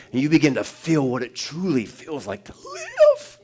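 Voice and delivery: rising, slow fervent preaching with a soaring high peak. Acted. Pastor describing heaven to congregation, fervent. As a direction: paint heaven for them, slowly.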